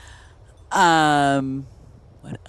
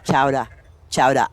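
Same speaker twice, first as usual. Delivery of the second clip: "Chowder" with a Boston dropped R; Boston accent; 5 semitones lower than usual. Shown as a demonstration. Showing how Boston says chowder, Boston accent. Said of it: "how you're supposed to say it in Boston".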